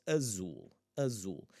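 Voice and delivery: azul with stressed 'oo' at the end; pronunciation demo. Shown as a demonstration. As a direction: model 'azul', falling on 'zul'. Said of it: "gonna have this sound".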